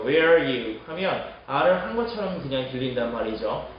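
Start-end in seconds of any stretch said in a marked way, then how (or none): 0.0-0.8 "Where are you?" as "wheeere-you"; "Where are you?" with "are" swallowed; lengthened "where". Shown as a demonstration. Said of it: "where 길게 발음해 주시면".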